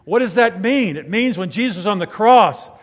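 high, loud pulpit proclamation; full-force preaching. Real, not acted. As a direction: build to a loud, drawn-out climax.

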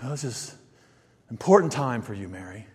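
low, very soft, tender; gentle aside to Mary. Acted. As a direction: speak softly, as if to her alone. Jesus voiced speaking tenderly to Mary.

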